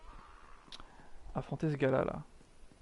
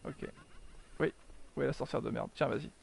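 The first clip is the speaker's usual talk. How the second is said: normal talking voice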